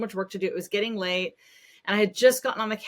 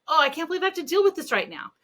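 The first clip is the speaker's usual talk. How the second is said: exasperated; 8 semitones higher, 3 dB louder, faster than usual. Retold or imitated. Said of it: "and I was just like".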